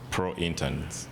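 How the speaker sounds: normal talking voice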